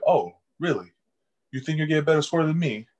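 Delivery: a light teasing dare; mock challenge. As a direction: taunt her playfully. Challenging classmate.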